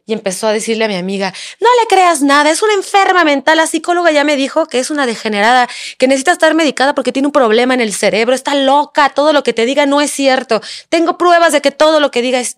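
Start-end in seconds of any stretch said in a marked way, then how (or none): 1.6-12.6 higher, pressing tirade; imitating furious partner. Partner badmouthing her to her friend, mock-furious rant. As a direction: imitate him ranting over her down the phone. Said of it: "se transformó en el demonio".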